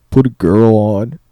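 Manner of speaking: very loud, drawn-out demand; imitated slow holler; mock demanding shout. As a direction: bellow it slowly like an obnoxious prank caller. Imitating prank caller's loud demand.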